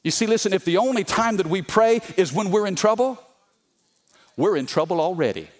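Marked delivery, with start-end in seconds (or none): none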